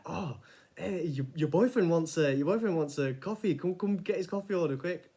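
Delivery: imitating Adam. Adam calling the girl up, loud teasing. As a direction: ham up the cheeky matchmaker. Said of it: "and he was like".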